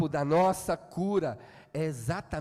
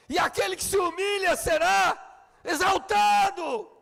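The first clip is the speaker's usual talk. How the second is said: gritado, bem mais agudo e mais alto; pregação a plenos pulmões; 10 semitones higher, 6 dB louder than usual. Acted. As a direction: martelando cada frase aos brados. Preaching at full force. (pregador gritando com toda a força)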